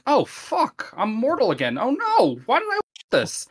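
hammed-up panicked whine; panicked Lestat. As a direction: squeal in mock horror at being mortal. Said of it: "immediately like".

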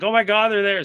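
hiss-free urgent heads-up to his fiance; a burst of starstruck panic; excited alarm. Retold or imitated. Spots the player leaving.

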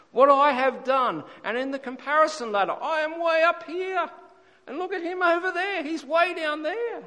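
high mock Pharisee bragging about his standing; boastful Pharisee. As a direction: puff yourself up above the lowly man.

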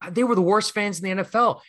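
imitated fired-up Philly passion, pitched high. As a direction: retell the rant with heated challenge. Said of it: "let his Philly passion jump out".

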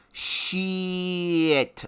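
bare the teeth and hiss out Shit; bared-teeth shit. Shown as a demonstration. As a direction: say Shit through bared teeth like a boiled bat. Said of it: "like that, but with your teeth out".

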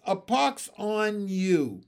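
restrained shout, drawn out; shouted curse. A narrator's reading. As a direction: hurl the curse slowly. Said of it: "I shouted at the bullfrog".

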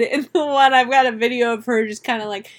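ordinary talk, nothing marked

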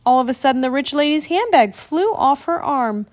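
ordinary delivery, nothing marked

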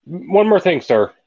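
polite but insistent, pitched well up; calling him back. Acted. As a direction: pipe up to stop him leaving. Player calls blacksmith back in character.

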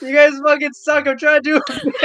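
loud, high-pitched comic outrage; exasperated yelling. Real, not acted. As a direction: scold your giggling friends at full volume. Berates laughing friends for derailing bit.

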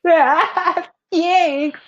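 squeaky mock thanks.

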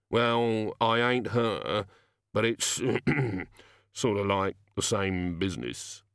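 halting gnome with throat-clearing; reluctant gnome. Acted. Gnome admitting his line of business, reluctant. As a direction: hedge slowly, hesitating between phrases.

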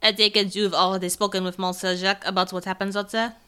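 put-on polite inquiry in a French accent; French-accented character. Asking about talk with Monsieur Jacques, in a French accent. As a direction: ask evenly, with a French accent.

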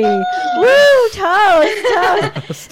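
loud, high whooping cheer; shouting a happy toast. Real, not acted. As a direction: raise a glass and whoop it up.

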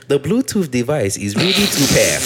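slow, stilted device-announcement voice, sounding vaguely Australian; mock robotic announcer accent. Friend mimicking a toilet's Bluetooth voice, stilted accent. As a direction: imitate the Bluetooth speaker lady badly. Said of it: "I don't know what accent that was".

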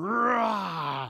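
drawn-out "rah" roar; imitated roar. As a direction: let out a demonstrative roar. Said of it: "I just begin to roar".

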